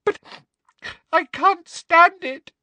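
hammed-up tearful breakdown; desperate fake sobbing. A narrator's reading. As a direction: wail it between put-on sobs. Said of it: "Hermione was sobbing desperately".

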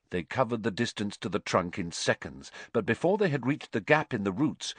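ordinary delivery, nothing marked

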